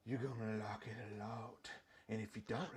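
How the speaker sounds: very soft, menacing whisper; creepy whisper. Pretend. Creepy bit, scares himself after.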